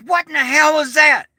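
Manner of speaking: a neighbour blurting it out; baffled neighbours. Retold or imitated. Retelling neighbours after the blast, high baffled blurt. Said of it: "all my neighbors are out going".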